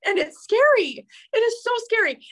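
high, tearful laugh into crying; half laugh, half sob; laughing through tears. Real, not acted. Admitting to viewers how scary sharing is, tearful. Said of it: "I'm gonna cry again".

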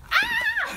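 sharp high shriek of fright; startled scream. Acted. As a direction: jump and shriek, caught off guard.

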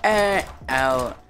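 loud, high, slow get out; drawn-out shout. Real, not acted. Gamer yelling at a rival player to get out, drawn-out shout. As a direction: stretch it out at full volume.

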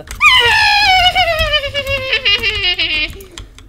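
long, loud high shriek breaking up; shrill burst trailing into stutters; high wailing cackle. Acted. Child lured with free pizza, long high cackling shriek.

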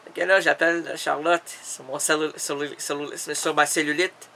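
usual speaking voice

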